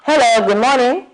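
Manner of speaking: to someone hard of hearing. Real, not acted. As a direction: call out over a bad phone line. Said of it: "Can you just speak up".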